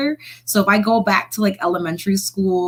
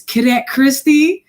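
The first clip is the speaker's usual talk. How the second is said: stern call; 6 semitones higher, 5 dB louder, slower than usual. Retold or imitated. Officer calls her name.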